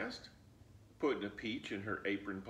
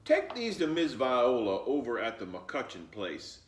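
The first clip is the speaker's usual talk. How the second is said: put-on louder, firm old grandmother; 2 semitones higher, 8 dB louder than usual. Grandmother sending Flossie with eggs, firmly. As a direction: send the child off like a no-nonsense grandma.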